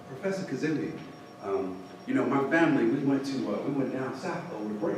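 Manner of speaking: soft student voice with halting pauses; low-key, tentative retelling; retold hesitant student. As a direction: quietly imitate a student easing into a story. Retold student approaching her professor.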